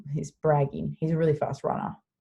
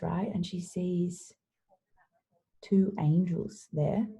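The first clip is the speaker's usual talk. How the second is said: plain reading voice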